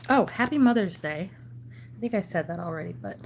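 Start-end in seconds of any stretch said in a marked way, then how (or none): 0.1-1.3 bright, lifted holiday greeting; cheerful greeting. Real, not acted.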